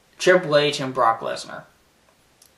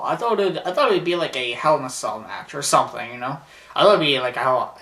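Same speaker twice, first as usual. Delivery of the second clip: ordinary delivery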